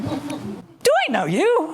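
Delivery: put-on horrified old gentleman. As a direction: drag it out in stiff disbelief. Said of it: "he's like, horrified".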